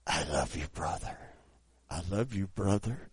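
low, soft, weak murmur; barely voiced into someone's ear; dying man's whisper. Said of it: "pulled me right down to where my ear was next to his mouth".

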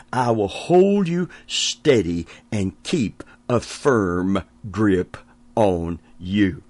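usual speaking voice

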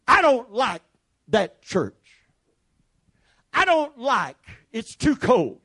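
high, loud whiny gripe; mock complainer. Preacher imitating murmuring church members, a high whiny gripe. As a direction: play a fussy churchgoer grumbling. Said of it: "when they murmured".

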